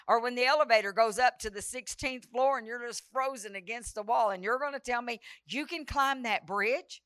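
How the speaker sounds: normal talking voice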